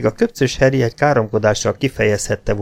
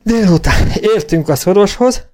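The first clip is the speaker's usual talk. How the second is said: high-pitched, breathless report; winded, broken delivery; panting; 10 semitones higher, 4 dB louder than usual. A narrator's reading. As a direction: gasp it out after a hard gallop. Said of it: "lihegte a karvajórú kóbor Jenny".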